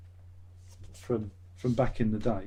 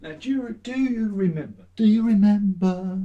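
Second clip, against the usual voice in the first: [name] impression; 8 semitones higher, 3 dB louder than usual. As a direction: imitate [name] crooning the [name] line. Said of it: "my impression of [name] doing [name]".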